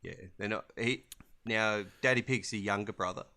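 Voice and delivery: ordinary talk, nothing marked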